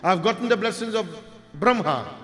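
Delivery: very loud, high proclamation, dropping in pitch into a threat; enraged boasting. Acted. Parashuram boasts of his blessings and threatens the boy, enraged. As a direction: thunder it out like an affronted warrior. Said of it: "Parshuram didn't want to hear this".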